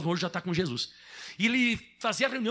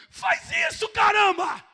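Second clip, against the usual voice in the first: high, loud and emphatic; urgent shout; 9 dB louder, slower than usual. Real, not acted. Preacher urging the church to do it, loud shout.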